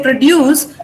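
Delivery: produce as pruh-DUCE; second-syllable stress. Shown as a demonstration. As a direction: push the weight onto DUCE in produce. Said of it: "you're stressing dues".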